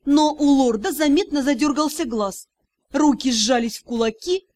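plain reading voice

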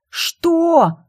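резкий высокий испуганный вскрик; вскрикнуть высоко и громко; крик. Acted. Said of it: "крик Тай". (Тай вскрикивает, услышав про тюрьму)

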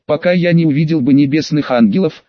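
plain reading voice

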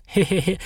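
a short, mock-sly old woman's cackle; witch cackle. A narrator's reading. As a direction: cackle like a village witch playing up her image. Old woman keeping witch image. (хитрый старушечий смешок ведьмы)

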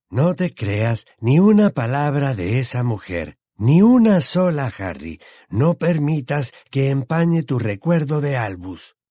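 fervent, slow insistence; emphatic urging. Acted. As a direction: hammer the denial, stretching the key words. Dodge fiercely defends his dead friend.

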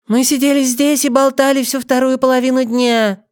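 high, rattled-off doll voice. Acted. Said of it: "протараторила Нэнси кукольным голосом". (кукольным голосом тараторит)

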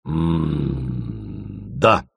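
long low, soft hum before answering; squeezed out. A narrator's reading. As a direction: force the answer out reluctantly. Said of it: "выдавил наконец Корнеев". (с трудом выдавливая)

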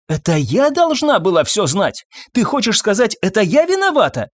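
high, accusing questions; indignant. A narrator's reading. Wife refuses blame from her husband, indignant. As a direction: throw the blame back with rising pitch.

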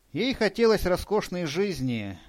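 ordinary delivery

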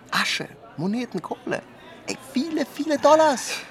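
high, excited gush over the money; gleeful greed. Acted. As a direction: rave about the cash, voice leaping high and loud. Gloating about the stock-market profit.

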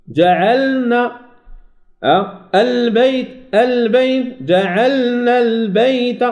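جعلنا البيت slow with a lingering ل; held لام. Shown as a demonstration. Teacher slowly modelling جعلنا البيت. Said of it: "توسط البينية".

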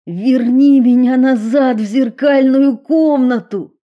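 shouted. Acted. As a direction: hurl each word. Will yells demand.

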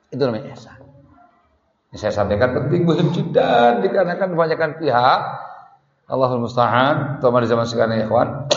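usual speaking voice

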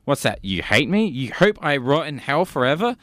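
ordinary talk, nothing marked

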